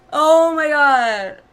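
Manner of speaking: slow, loud, stunned oh my god; drawn out in real shock; stunned gasp. Real, not acted. Shocked by character's fate.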